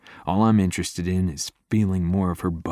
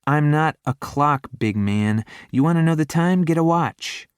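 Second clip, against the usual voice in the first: high, louder sassy retort; 9 semitones higher, 4 dB louder than usual. A narrator's reading. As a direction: snap back with cheeky sass.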